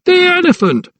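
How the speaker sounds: very high frantic cry; screamed warning. A narrator's reading. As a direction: scream over the roar of flames. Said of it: "he screamed over the sound".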